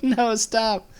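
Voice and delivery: high-pitched cry to stop; mock panicked plea. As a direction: imitate someone begging while hanging on. Chased man clings to a rope.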